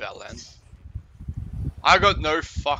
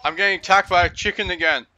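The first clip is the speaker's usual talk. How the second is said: panicking gamer; 4 semitones higher, 6 dB louder, much faster than usual. Real, not acted. Chased in game, panicked.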